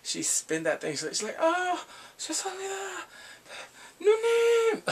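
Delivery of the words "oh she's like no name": mock squeal of fear. Punk spun on merry-go-round.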